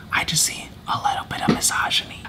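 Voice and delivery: whispering. Real, not acted. Whispered aside about the show.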